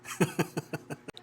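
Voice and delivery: low breathy laugh; quiet amused laugh; low chuckle. Real, not acted. Laughs after teasing.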